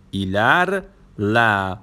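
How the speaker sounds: "ایلرله" drawn out, pause before the final "له"; split for learners. Shown as a demonstration. Teacher showing learners a new word, "ایلرله" split slowly. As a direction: break "ایلرله" into two slow parts, ایلر... له.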